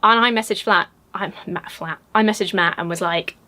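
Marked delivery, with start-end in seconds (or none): none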